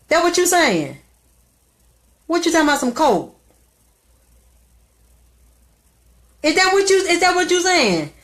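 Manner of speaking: high, loud sneering questions; mocking mother. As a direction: taunt like the sadistic mother. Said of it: "She sat in my face and said".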